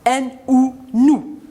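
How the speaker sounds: letters one by one, then blended into "nous"; spelled-out syllable. Shown as a demonstration. Teacher showing children the syllable, spelled then blended. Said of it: "La deuxième syllabe est composée du son OU".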